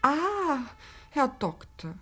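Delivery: usual speaking voice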